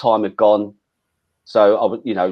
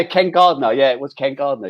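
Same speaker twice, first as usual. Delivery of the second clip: usual speaking voice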